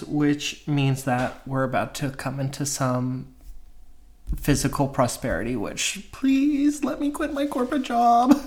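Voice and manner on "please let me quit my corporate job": high-pitched, hammed-up begging; mock pleading. Jokingly begs the cards for freedom.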